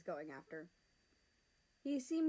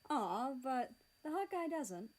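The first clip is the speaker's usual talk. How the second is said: awkward chuckle; 5 semitones higher, slower than usual. A narrator's reading. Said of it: "America awkwardly chuckled".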